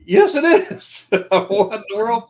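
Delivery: slow, loud, high, amazed yes; surprised reply. Retold or imitated. Surprised at being recognized, slow, high and loud. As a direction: marvel at being recognized, slowly. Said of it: "reply to the lady".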